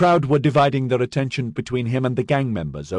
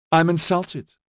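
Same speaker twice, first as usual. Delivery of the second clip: mock offended, nose in the air; lofty; 9 semitones higher than usual. A narrator's reading. Lofty, higher mock hurt tone. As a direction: sergeant teasing the officer, lofty mock offence. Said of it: "he said loftily".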